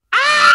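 high, loud shriek; girl screaming. A narrator's reading. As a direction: scream in panic. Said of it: "She screamed".

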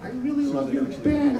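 high, drawn-out sheepish fan voice; mock apologetic fan. Cowed hecklers to the towering band, mock apologetic. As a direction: put on a high, pleading apology. Imitating contrite hecklers on stage.

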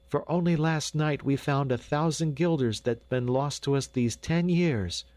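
high, excited woman's voice; excited exclamation. A narrator's reading. Dame telling the doctor of found money, excited. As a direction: pour out the news, high-pitched. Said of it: "cried the dame".

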